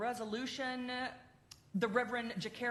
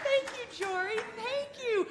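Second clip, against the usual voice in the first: emotional thanks; 13 semitones higher, 7 dB louder than usual. Real, not acted.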